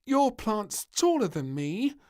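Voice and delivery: high, loud, slow grumble; a short girl envying a plant; piping grumble; grumbling princess. A narrator's reading. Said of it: "grumbled the little princess".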